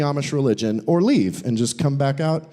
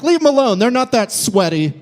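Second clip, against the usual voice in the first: mock defender; 8 semitones higher, 4 dB louder than usual. Mock article defending juggalos.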